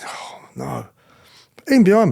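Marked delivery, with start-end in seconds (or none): 0.1-0.9 breathless whispered dread, fading to nothing; scared inner whisper. Retold or imitated. New inmate walking past jeering landings, frightened whisper. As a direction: hush it like a sinking realisation. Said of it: "walking through thinking".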